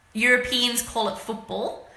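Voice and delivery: ordinary talk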